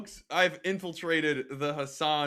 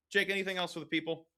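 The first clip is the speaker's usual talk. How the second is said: usual speaking voice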